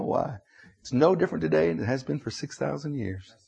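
ordinary delivery

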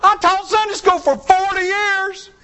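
high, loud outraged protest; indignant holler. Pretend. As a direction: shout back in disbelief. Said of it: "a voice that hollers back".

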